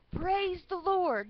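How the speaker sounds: high, loud, drawn-out cry to heaven; exultant praise. A narrator's reading. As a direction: sing out the praise, high and slow.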